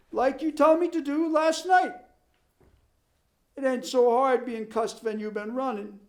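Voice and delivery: high, complaining Swedish farmhand; Swedish accent. Acted. Ole's immigrant dialect voice.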